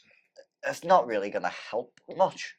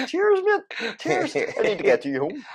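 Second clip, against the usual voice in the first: much higher, mock-sweet thanks; squeaky thank-you to the dog. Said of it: "I'm like".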